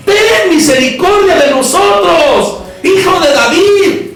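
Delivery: high, slow, drawn-out cry; exclaiming with enthusiasm. Acted. Blind men begging the son of David, high fervent cry. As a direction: cry it out with fervour, stretching the words. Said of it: "Así, con admiración, con entusiasmo".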